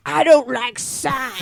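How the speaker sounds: slow, put-on voice of a roommate; exaggerated mock protest; mocking imitation. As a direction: imitate a roommate's objection, dragged out. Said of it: "Chris goes".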